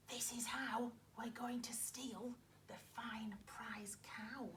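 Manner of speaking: hushed conspiratorial whisper; robbers whispering. Acted. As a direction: whisper the robbers' secret plan. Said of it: "she heard them whisper".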